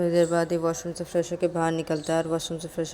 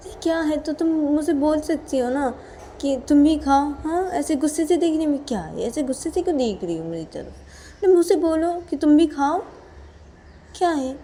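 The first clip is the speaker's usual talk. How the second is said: high, loud scolding in a quarrel; acted angry retort; 10 semitones higher, 7 dB louder than usual. Character told off for glaring demands to be asked to eat, angry retort. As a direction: snap back, pitched high and loud.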